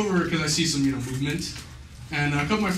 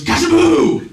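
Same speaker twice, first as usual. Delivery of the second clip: high, loud spell cry; witch's incantation; 11 semitones higher, 11 dB louder, slower than usual. Retold or imitated. Girl casting a spell over the tear, loud high cry. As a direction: cast the spell with a bang.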